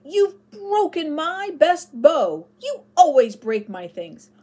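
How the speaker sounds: put-on boy shouting at his friend who broke his bow; furious shouting. A narrator's reading. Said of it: "shouted David furiously".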